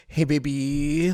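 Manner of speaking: slow, drawn-out greeting; stretched-out mock flirty hello; lightly voiced caller. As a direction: imitate a smug phone caller, lightly. Voices Charles on the phone.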